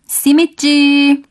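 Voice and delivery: long, high hawker's call held on the last syllable; street vendor's cry. Retold or imitated. Street vendor calling out simit to passers-by, drawn-out cry. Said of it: "os vendedores a gritar em voz alta".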